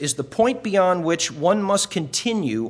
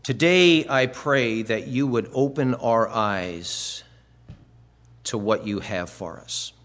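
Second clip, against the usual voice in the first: prayer; 6 semitones lower than usual. Acted. Opening prayer before the sermon.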